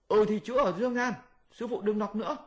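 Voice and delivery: high voice with a pained laugh; high Monkey King, half laughing; Monkey King giving in. Acted. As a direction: concede quickly to stop the pain. Monkey gives in under spell.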